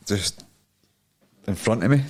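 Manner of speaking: ordinary talk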